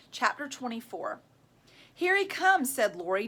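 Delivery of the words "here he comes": excited. A narrator's reading. Girls waiting to confront Jeff.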